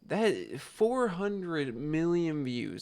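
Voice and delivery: very slow, each word weighed out; slow emphasis. Real, not acted. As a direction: let the size of the number sink in slowly. Dwelling on the huge number.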